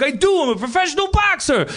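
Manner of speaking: high, indignant big guy; thick-accented boxer. Retold or imitated. Big boxer answering people who laugh at him, high and defensive. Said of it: "really thick, uh, accent".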